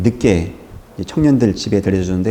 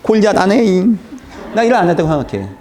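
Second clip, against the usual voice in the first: sulking David; 10 semitones higher than usual. Retold or imitated. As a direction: whine it like a sulking kid refusing to fight. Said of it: "다윗이 막 삐져가지고".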